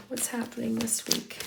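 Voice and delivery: normal talking voice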